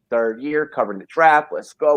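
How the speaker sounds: normal talking voice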